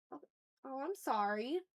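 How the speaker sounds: ordinary delivery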